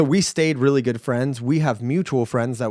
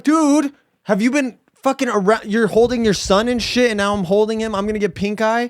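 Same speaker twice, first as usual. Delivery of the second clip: high, panicky mock accusation; high paranoid quote; paranoid accusing; 7 semitones higher than usual. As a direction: grill him in a high worried voice. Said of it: "And then I'm like".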